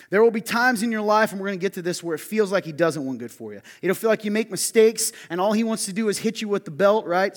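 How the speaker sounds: loud emphatic preaching. Acted. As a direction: drive the point home to the congregation. Preaching build to congregation.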